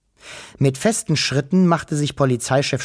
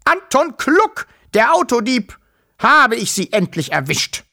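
very high, loud yell, easing slightly; triumphant shout; 10 semitones higher, 4 dB louder than usual. A narrator's reading. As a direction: crow over the thief you finally caught. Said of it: "schrie Polizeichef Stockmeier". (triumphierend und hoch schreien)

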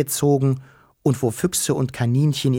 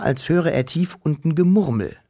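usual speaking voice

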